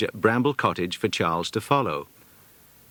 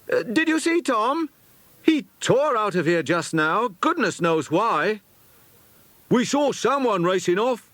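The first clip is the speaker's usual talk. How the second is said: high, surprised schoolboy voices; puzzled boys; 12 semitones higher than usual. A narrator's reading. Peter and Jack puzzling over Tom racing off, surprised. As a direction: lads baffled by a runaway.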